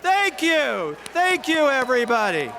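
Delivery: high, loud thank-you to a crowd; exhilarated loud thanks; shouted thanks. Real, not acted. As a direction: call out thanks over the cheering. Shouts thanks after crowd scream.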